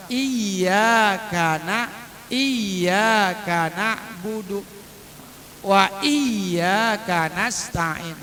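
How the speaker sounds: slow chanted "iya" with the y held long; stressed doubled y. Shown as a demonstration. Teacher models the tasdid, "iya" slowly chanted with held y. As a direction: press and stretch the y in each "iya". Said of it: "Maka dibacanya ada penekanan".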